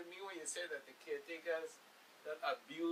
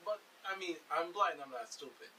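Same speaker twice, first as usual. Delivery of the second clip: usual speaking voice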